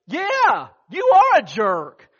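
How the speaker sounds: loud, high, slow shouted insult; angry agreement. Retold or imitated. A second buddy shouting his agreement at the husband, angry. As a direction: pile on with open contempt. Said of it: "The next guy says".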